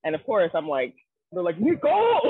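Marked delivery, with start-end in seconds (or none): 1.6-2.2 high, loud call of her name; retold fan yell. Japanese fan yelling at the wrestler, loud and high. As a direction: shout it across the arena like an excited fan. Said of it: "they were always yelling at me".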